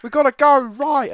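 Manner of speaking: high, loud mimicry of clamouring teammates; exaggerated high-pitched shout, mocking the crowd; mock team shouting. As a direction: yell it like panicky players calling directions. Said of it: "everyone's been saying".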